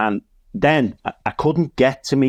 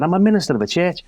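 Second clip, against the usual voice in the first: fast, raised repeat of the punchline; repeated reveal; 7 semitones higher, much faster than usual. Retold or imitated. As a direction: hammer the reveal home again, quickly.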